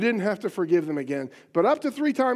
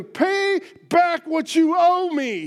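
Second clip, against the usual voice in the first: loud, high, slow angry demand; 9 semitones higher, 6 dB louder, slower than usual. Acted. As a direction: bark the demand at the debtor. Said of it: "he demanded".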